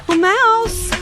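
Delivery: solemn, ceremonious call to the mouse; grand, high formal greeting; formal address. Acted. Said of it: "this must be the right way to address a mouse".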